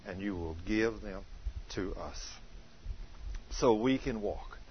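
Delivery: soft, low reverent prayer. Acted. Pastor closing the service, soft reverent prayer.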